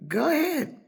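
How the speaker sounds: high, loud sing-out to another room; calling out permission. Retold or imitated. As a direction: call it out high to your guests. Calling permission to friends while on phone.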